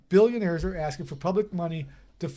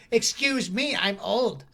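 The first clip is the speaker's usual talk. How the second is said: put-on higher, prissy old-lady voice; indignant old lady; 4 semitones higher, much slower than usual. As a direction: play the offended old woman. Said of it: "she looked at me and was like".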